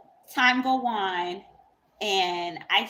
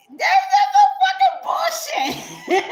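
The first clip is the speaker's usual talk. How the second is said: very high, loud, slow squeal of laughter; laughing shriek; 9 dB louder than usual. Real, not acted. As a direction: shriek the words out through laughter. Breaks up mocking how he talks.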